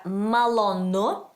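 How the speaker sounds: Malonu drawn out very slowly, last syllable rising; accented Malonu. Shown as a demonstration. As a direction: stretch Malonu for a learner, lifting the end. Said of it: "The accent is there".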